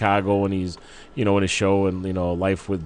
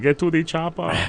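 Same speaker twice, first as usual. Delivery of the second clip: higher-pitched Austrian Arnold accent put on for a quote; Arnold Schwarzenegger impression; 8 semitones higher than usual. A host mocks Arnold's accent on the radio, Austrian Arnold accent. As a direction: clip the words the way the Terminator would. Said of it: "It's more like".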